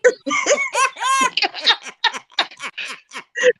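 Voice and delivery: high, long burst of laughter; big laugh. Real, not acted. Teasing a sister about sharing mama, helpless laughter. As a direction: crack up at your own teasing.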